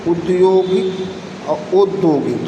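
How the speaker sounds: उद्योगिक and औद्योगिक read slowly, the vowel औ set apart; slow contrast. Shown as a demonstration. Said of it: "औद्योगिक यही शुद्ध है". (उद्योगिक और औद्योगिक धीरे-धीरे)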